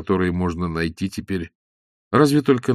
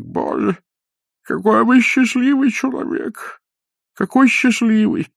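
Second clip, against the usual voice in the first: high, slow envious marvelling; old man envying Ostap's dreams; delighted old man; 13 semitones higher than usual. A narrator's reading. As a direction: marvel at his luck, enraptured. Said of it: "произнёс старик".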